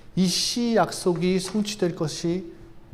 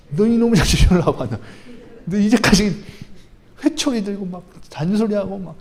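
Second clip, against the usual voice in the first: a louder, hammed-up parent telling off a child; scolding parent; 3 semitones higher, 5 dB louder than usual. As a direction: play the exasperated father with a switch, half in jest. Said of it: "우리 어머니, 아버지가 저 혼내는 것 같네".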